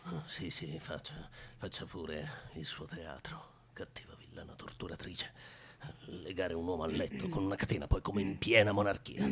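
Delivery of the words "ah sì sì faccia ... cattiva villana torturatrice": slow whispered grumble under his breath; whispered aside. Real, not acted. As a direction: hiss it so she can't hear. Muttering insults aside to himself. (sussurro lento tra sé e sé)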